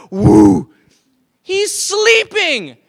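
amazed. Real, not acted. Preacher marvels at sleeping Jesus.

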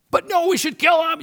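high, loud abrupt reversal; sudden harsh turn. Retold or imitated. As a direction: snap back to murderous resolve. Priest's sudden turn after praise.

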